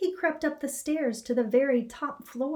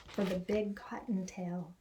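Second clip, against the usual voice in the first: whispering; 3 semitones lower, 7 dB quieter than usual. A narrator's reading. Narrator whispers suspense.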